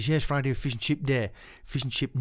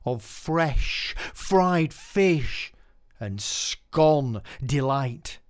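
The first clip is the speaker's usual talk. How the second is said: slow, rhythmic, savouring the words; poem recital; 4 semitones higher, 4 dB louder, slower than usual. Acted. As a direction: paint the memory line by line. Performs Chippy poem.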